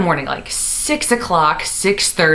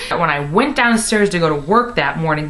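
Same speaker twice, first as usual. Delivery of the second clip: ordinary delivery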